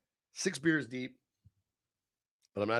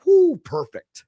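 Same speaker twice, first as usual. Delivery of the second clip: excited whoop; 9 semitones higher, 5 dB louder, slower than usual. Real, not acted. Admiring his old mohawk.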